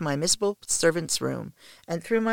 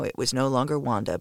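ordinary delivery, nothing marked

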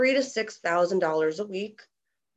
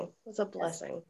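much softer, heartfelt echo; soft repeat; 3 semitones lower, 11 dB quieter than usual. Real, not acted. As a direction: say it again softly, to yourself. Thanks, softly repeated.

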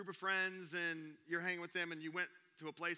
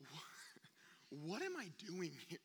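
lost and quiet; 8 dB quieter, slower than usual. Retold or imitated. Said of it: "I was like".

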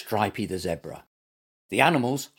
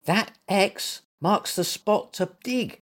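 slow, higher, helpful explanation; 8 semitones higher, slower than usual. A narrator's reading. Stripey explaining the plan to Gumper, helpful. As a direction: point it out helpfully, taking your time. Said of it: "said Stripey helpfully".

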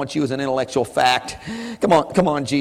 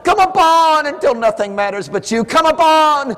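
high, loud fervent pleading; full-force preaching prayer; 12 semitones higher, 5 dB louder than usual. Real, not acted. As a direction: pour it all out to God at the top of your voice.

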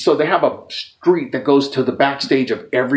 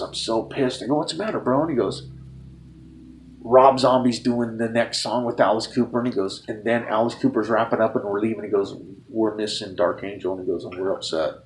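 quiet bummed; 4 semitones lower, 6 dB quieter than usual. Retold or imitated. Johnny Five upset about missing show.

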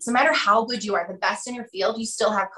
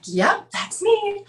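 sheepish admission; 10 semitones higher, much slower than usual. Retold or imitated. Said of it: "And I'm like".

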